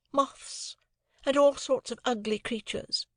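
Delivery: put-on cool, airy society girl; Estella. Estella voiced, higher.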